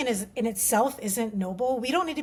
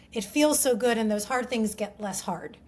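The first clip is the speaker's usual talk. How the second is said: normal talking voice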